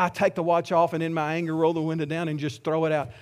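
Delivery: normal talking voice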